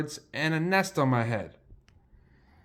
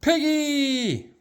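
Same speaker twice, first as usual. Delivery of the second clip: calling out; 9 semitones higher than usual. Acted. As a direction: shout for Piggy's attention. Elephant calls out to his friend.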